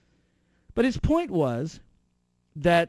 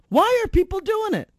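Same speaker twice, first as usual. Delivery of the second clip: emphatic repeat; 5 dB louder than usual. Real, not acted. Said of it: "ask yourself".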